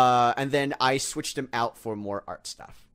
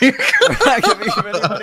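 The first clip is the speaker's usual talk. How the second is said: laughing; 9 semitones higher, 7 dB louder than usual. Real, not acted. Friends joking about paying Kazapar.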